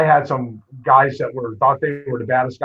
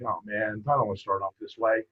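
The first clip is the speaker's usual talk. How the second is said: muttered worry; 4 semitones lower, 9 dB quieter than usual. Retold or imitated. Coach's inner worry as kid loses.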